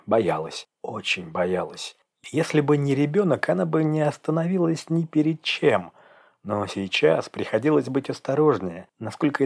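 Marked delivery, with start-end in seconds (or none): none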